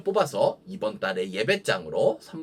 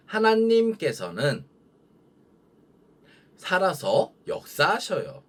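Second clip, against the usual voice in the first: slow, high, drawn-out line for repeating; call-and-repeat model; 4 semitones higher than usual. Shown as a demonstration. Preacher to children repeating after them, slow lead-in voice. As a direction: lead the children slowly in raised pitch, pausing between phrases. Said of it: "큰 목소리로 읽어보도록".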